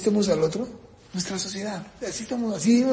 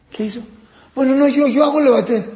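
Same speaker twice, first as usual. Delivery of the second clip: usual speaking voice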